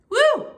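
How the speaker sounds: high loud whoop; excited whoop. Real, not acted. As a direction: whoop with joy. Celebrating new bed.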